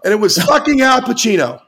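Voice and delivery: high, loud, amazed punchline; shouting in disbelief. Real, not acted. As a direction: yell it out in disbelief. Punchline of celebrity story.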